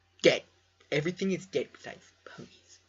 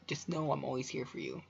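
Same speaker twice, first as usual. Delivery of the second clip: usual speaking voice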